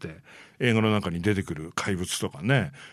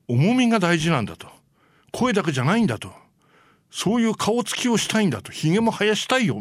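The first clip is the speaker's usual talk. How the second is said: raised-pitch mock pleading of an actor; insistent direct appeal; 9 semitones higher than usual. Actor pleads with the producer to show his face, insistent. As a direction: press your case, voice pitched well up. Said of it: "アビー・アレンに直談判して".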